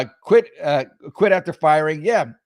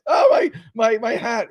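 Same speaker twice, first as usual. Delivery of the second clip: high, whiny fake lament; mock whiny mayor; 8 semitones higher, 4 dB louder than usual. Mocking the mayor over his hat, high whiny lament. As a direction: moan like a pampered crybaby.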